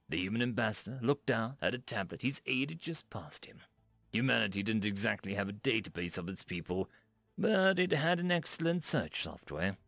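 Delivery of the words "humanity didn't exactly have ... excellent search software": plain reading voice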